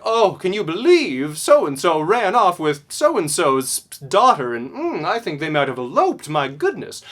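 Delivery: hammed-up shocked gossip, savoring the scandal; pearl-clutching church gossip. Teacher voicing a church lady gossiping about an elopement, loud and scandalized. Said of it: "she clutched her pearls and said".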